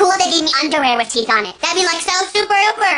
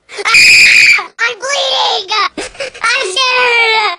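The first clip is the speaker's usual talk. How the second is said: panicked scream; 7 semitones higher, 6 dB louder, much slower than usual. Acted. Panicked about bleeding mouth.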